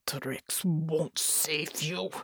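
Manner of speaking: slow, breathy growl through clenched teeth; growled through gritted teeth. Acted. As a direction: hiss it out slowly, teeth gritted. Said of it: "he growled through gritted teeth".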